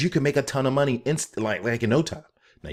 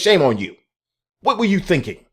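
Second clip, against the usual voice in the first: mock scolding; 9 semitones higher, 6 dB louder than usual. Scolding Coinbase mockingly.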